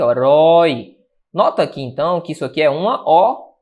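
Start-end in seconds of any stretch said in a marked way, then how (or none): 0.1-1.0 "Rói" drawn out and loud; stressed syllable. Shown as a demonstration. Teacher pointing out herói's tonic syllable, stressed syllable. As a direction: punch "Rói" as the strong syllable. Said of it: "a sílaba tônica tá aqui".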